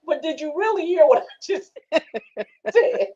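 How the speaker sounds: high, slow pressing question breaking into laughter; drawn-out playful grilling with laughter; laughing mock interrogation. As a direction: press on "really", then crack up.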